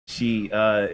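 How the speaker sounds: ordinary talk, nothing marked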